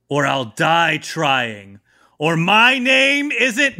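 drawn-out heroic oath; hammed-up slow vow; slow dramatic vow. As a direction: stretch it out grandly. Guard's grand vow.

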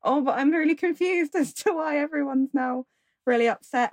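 a confused giggle through the line; confused laughing. Retold or imitated. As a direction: play it baffled with a laugh. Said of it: "she's just like".

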